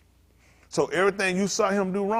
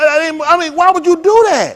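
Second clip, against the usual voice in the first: indignant; 10 semitones higher, 10 dB louder than usual. Real, not acted. Indignant at the lying man.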